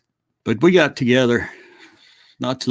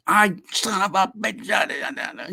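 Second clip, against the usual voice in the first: mock outburst; 8 semitones higher, 4 dB quieter, much slower than usual. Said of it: "Daddy went off".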